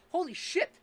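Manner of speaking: high, loud holy shit; amazed. Real, not acted. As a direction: react in stunned disbelief. Reacting to the imagined comeback.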